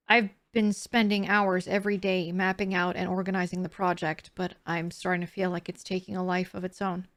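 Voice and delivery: soft, low storytelling read; hushed sleep story narration; bedtime story voice. A narrator's reading. Reads journal entry aloud.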